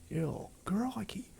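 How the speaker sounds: mock Aussie. Australian player jokingly doing a broad Aussie accent. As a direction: play up the Australian accent for a laugh. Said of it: "Now, I am Australian".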